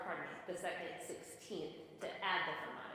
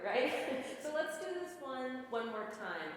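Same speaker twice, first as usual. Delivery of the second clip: ordinary delivery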